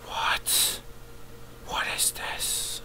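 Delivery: slow, hushed whisper; baffled whisper. Real, not acted. Viewer puzzled by a new trailer, slow whisper. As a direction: whisper it slowly in bewilderment.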